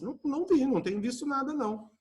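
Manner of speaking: high, quick, dodging denial; evasive denial. Retold or imitated. Student answers a neighbour's question with an evasive denial. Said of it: "ela é evasiva".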